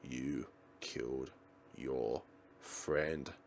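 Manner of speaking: eerie accusing voice. Acted. A voice in her head accusing the heroine, slow and eerie. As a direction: intone it like a ghost in her head.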